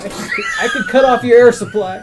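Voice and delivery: loud, high, laughing menace; laughing threat. Acted. Playful threat while laughing.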